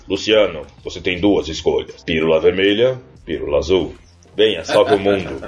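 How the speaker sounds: mentor voice. Acted. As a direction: speak low like a mysterious guide. Matrix mentor offering the pills.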